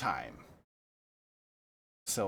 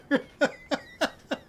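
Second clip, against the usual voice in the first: laughing hard, pitch shooting up; high giggling laugh; 3 dB louder than usual. Real, not acted. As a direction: burst out giggling. Cracking up at story.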